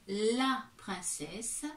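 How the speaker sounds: each syllable drawn out slowly while writing; teacher spells it out slowly for the child; slow dictation. Shown as a demonstration. Teacher dictating to a child as she writes, very slow. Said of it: "Alors, donc".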